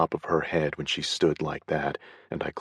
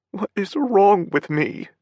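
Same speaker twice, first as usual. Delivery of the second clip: higher, louder distraught cry; tearful; 6 dB louder than usual. A narrator's reading. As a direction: despair through tears. Paige in tears.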